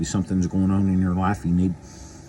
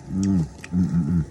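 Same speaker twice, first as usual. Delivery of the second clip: savoring mmm; 2 semitones lower than usual. Real, not acted. Savoring jerky.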